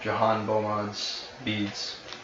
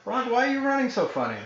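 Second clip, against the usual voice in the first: pitched way up and fast, teasing; high mock voice; 12 semitones higher, faster than usual. GM teasing Rock in a high girlish voice. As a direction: squeak it out like a nosy girl.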